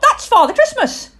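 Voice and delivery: very high, loud squeal of protest; piercing childlike outcry; owlet shriek. A narrator's reading. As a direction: shriek it like a startled little owl. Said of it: "shrieked Plop".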